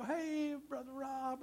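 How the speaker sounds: high, softened, stretched-out hello; acted cheery high-pitched reply; friendly greeting back. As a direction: imitate a student happily greeting back.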